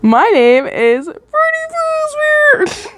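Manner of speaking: very high, slow cartoonish voice; silly fake name. Reader joking about a fake name, cartoon voice. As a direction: announce it like a goofy mascot.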